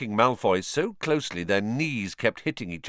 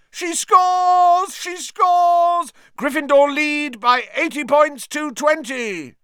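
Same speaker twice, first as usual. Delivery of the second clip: jubilant commentator; 6 dB louder, slower than usual. Acted. Commentator celebrates goal.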